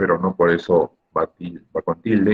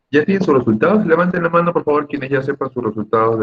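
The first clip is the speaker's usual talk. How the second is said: higher, louder call to the whole class; teacher calling out to the class, higher and louder; 9 semitones higher, 5 dB louder than usual. Real, not acted. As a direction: raise your voice to get the students' attention.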